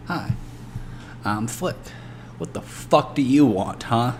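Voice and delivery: lightly voiced wacky street accent, rough edge. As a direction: play a sketchy dealer with a silly accent. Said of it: "What's with the wacky accent".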